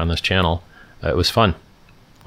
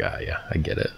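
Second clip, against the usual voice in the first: hushed mock realization; 2 semitones lower, 10 dB quieter than usual. Imagined reaction to school stats.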